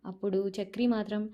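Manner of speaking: ordinary delivery, nothing marked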